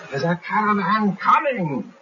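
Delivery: high, loud, drawn-out cartoon scream; screaming lookout. Sergeant Hook screams at the bored brigands that a caravan is coming, high and slow. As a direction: shriek the alarm slowly at full pitch. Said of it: "And he screams".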